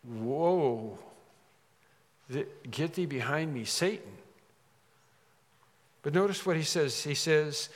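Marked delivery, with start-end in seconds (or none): 0.0-4.1 hushed, stunned echo; acted shock. Peter reeling from the Lord's rebuke, acted stunned shock. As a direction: repeat the rebuke softly, halting in disbelief. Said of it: "someone who was really shocked".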